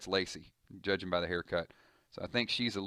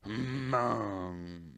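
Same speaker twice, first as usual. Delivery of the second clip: zombie groan. Acted. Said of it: "That's my zombie sound effect".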